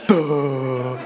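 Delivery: sarcastic Duh, laughing; mock duh with a laugh. Speaker mocking the book's wording, laughing duh. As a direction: Duh said with a chuckle as a mocking punchline. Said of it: "he would have written, "Duh".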